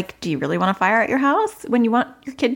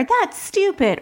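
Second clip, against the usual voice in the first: put-on much higher snotty child retort; defiant child; 12 semitones higher, slower than usual. Child retorting to parent at bedtime, high and snotty. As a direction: snap it like a cross little kid. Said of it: "bark back".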